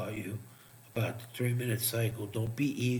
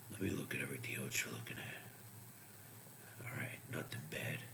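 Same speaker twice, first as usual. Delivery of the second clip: whispering to himself; 10 semitones lower, 16 dB quieter than usual. Real, not acted. Muttering to himself over the charts.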